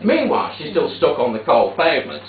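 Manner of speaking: normal talking voice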